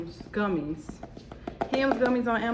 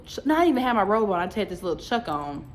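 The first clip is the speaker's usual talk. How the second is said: ordinary delivery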